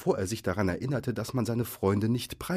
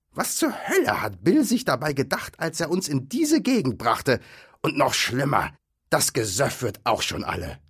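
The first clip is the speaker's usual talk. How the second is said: higher, louder troll grumble; complaining troll; 7 semitones higher, 5 dB louder than usual. A narrator's reading. Troll blaming Bill for bringing them here, indignant grumble. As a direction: grouse loudly as an annoyed troll.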